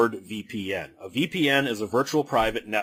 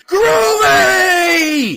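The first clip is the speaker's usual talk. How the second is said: Groovy screamed much higher and louder, held long; crowd scream; 10 dB louder than usual. Retold or imitated. Audience screaming the line back at the screen, a long mock scream. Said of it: "the whole audience screams".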